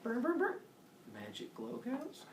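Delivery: high, soft sing-song Hermes; a high, teasing Hermes voice. Retold or imitated. Hermes voiced in retold myth.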